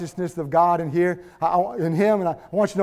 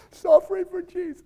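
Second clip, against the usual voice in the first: high, softer pitiful whimper; mock griping; 9 semitones higher, 7 dB quieter than usual. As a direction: trail off in hammed-up self-pity. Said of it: "griping and complaining and saying".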